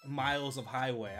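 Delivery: ordinary talk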